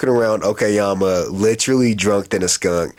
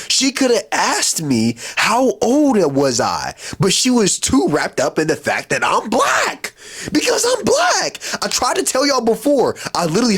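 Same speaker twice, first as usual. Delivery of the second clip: much higher, climbing to an incredulous peak; heated rant; 12 semitones higher than usual. Real, not acted. Ranting to viewers about why she left, rising excitedly.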